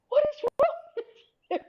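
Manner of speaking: very high, slow imitation of a baffled boss; mock exasperated. Boss to giggling employee in the meeting, mock exasperated. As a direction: squeal it in hammed-up disbelief.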